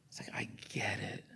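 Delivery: an unvoiced, hushed aside; whispered aside. Real, not acted. Pastor confiding to the congregation in a whisper. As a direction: drop to a bare whisper. Quiet aside about listening.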